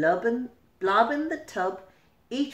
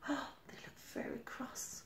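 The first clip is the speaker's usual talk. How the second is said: hushed gasping aside; gasp then whisper; 4 semitones higher, 17 dB quieter than usual. A narrator's reading. Reader whispers to child about the quarrel.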